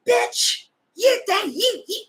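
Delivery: very high, sputtering mock shriek; mock outraged commenters. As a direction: imitate angry commenters squawking in outrage. Voices hostile commenters attacking her.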